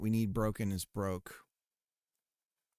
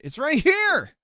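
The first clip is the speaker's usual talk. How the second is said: higher and louder, pressing it; frustrated high insist; 14 semitones higher, 11 dB louder, slower than usual. Real, not acted. Streamer argues with the game that can't find it, loud insistence. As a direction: insist the thing is right there. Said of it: "it's saying it can't find it".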